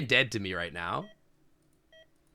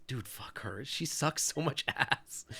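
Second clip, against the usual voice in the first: muttered scorn; 7 dB quieter, slower than usual. Real, not acted. Muttered scorn, near whisper.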